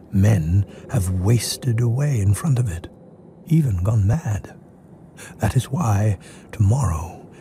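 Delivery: ordinary talk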